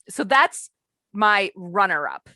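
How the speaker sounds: ordinary talk, nothing marked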